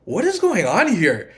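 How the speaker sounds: slow, puzzled question; bewildered. Retold or imitated. Confused by partner's silence, slow bewildered question. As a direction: ask it slowly, baffled.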